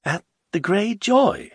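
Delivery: a doubtful, questioning rise. A narrator's reading. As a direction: ask it unsure. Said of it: "Rumata asked doubtfully".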